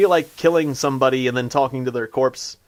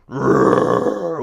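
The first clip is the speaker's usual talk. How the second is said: imitating roar; 4 semitones lower, 4 dB louder than usual. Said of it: "The chicken just roared at me".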